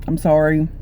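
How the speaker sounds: ordinary delivery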